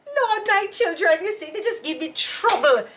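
high, laughing mock praying mom; high-pitched mock church mother, laughing; imitating mother. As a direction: complain to the Lord like mom. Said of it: "Mommy doesn't sound like an uptown bro".

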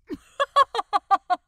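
loud high mwahahaha laugh; evil supervillain cackle. Acted. Aziraphale gloating over Crowley, evil cackle. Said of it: "evil supervillain, mwahahaha kind of laugh".

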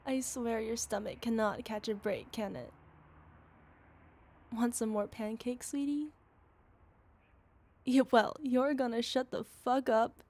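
quiet playful scolding; soft teasing. Acted. Teasing her boyfriend about his hunger, softly playful. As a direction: tease him gently.

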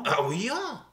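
mock French with a grunt; French accent. Host clowning for co-host, grunty mock French. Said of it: "that's my French".

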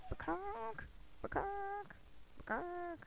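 high squeaky hen cluck; chicken clucking. Pretend. Imitating chicken near chickens.

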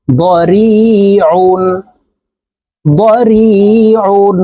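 দরিউন slowly, the আইন pressed out from the middle of the throat; pressed ain. Shown as a demonstration. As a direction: stretch দরিউন and squeeze the আইন from mid-throat. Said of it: "এই আইন হরফকে হলকের মধ্যখান থেকে চাপ দিয়ে উচ্চারণ". (দরিউন ধীরে, আইন হলকের মধ্যখান থেকে চাপ দিয়ে)

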